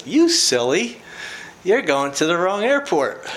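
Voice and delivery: put-on fond chiding, like to a goofy kid; tender teasing voice of God. God wakes him to say he has the wrong airport, tender and teasing. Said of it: "I hear God's still small voice again".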